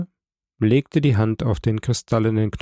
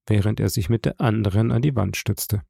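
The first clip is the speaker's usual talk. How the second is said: ordinary delivery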